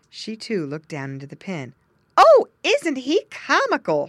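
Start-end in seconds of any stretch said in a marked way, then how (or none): 2.2-4.1 high, loud, slow delight; loud high delighted farm wife; delighted cry. A narrator's reading. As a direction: coo over the funny pig. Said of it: "she cried".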